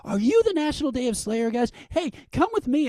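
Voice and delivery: imitating an eager, high-pitched welcome; excited greeting. Musician's wife running up to the fans, excited greeting. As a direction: pitch way up, thrilled to have spotted them.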